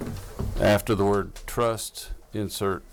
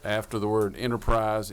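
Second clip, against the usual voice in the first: ordinary talk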